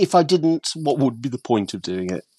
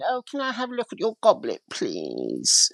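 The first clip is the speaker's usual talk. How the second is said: posh dealer impression; 7 semitones higher, 5 dB quieter than usual. Dealer asking to see the goblet, high pained posh voice. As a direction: lift the voice high and sound put upon by the whole exchange. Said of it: "he spoke like this".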